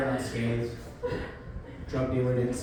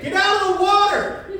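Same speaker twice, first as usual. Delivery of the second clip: high, loud shout over surf; urgent yell from the shore; screamed warning; 9 dB louder than usual. Acted. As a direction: scream it to distant swimmers. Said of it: "they are screaming something".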